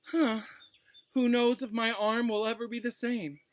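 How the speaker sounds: milk the fake injury; fake self-pity. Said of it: "he gave a huge fake sigh".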